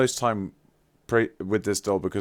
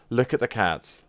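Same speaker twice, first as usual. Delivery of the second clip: ordinary talk, nothing marked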